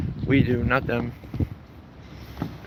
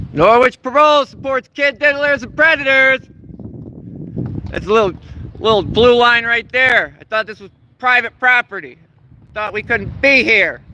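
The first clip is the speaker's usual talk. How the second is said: raised, higher protest shouting; calling out; 8 semitones higher than usual. Real, not acted. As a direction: call it out across the lot. Protester calling out at parole office.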